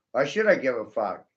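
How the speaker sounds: usual speaking voice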